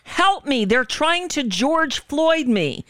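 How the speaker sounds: high-pitched retold plea; imitated scream for help. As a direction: mimic a man desperately crying out, without full screaming. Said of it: "you hear him screaming".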